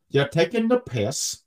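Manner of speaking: slow attempt at a British accent; mimicked British accent. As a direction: copy the Love Island British accent, drawn out. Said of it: "I'm gonna say that tomorrow".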